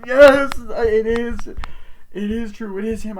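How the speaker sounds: real exasperated yell; indignant shout. Real, not acted. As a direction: protest the wrong answer. Reviewer objects to the film's answer.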